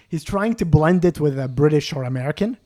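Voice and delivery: ordinary delivery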